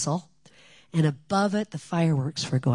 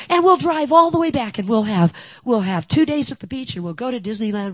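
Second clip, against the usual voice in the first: high, loud gushing plans; excited daughter; 8 semitones higher, 9 dB louder than usual. Retold or imitated. Daughter listing the trip plans to mom, high and loud. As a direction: bubble over with the road trip idea.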